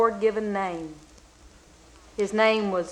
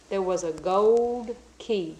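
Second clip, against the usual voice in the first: ordinary delivery, nothing marked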